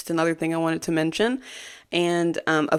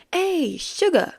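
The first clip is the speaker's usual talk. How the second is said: high, slow, odd put-on accent for Carmy's phone greeting; strange accent; 13 semitones higher, slower than usual. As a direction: mimic his strange accent, drawn out and high. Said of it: "this strange accent".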